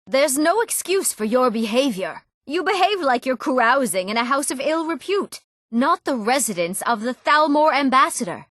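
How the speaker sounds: high, affronted reprimand; indignant scolding. Acted. Acted scolding of a misbehaving guest.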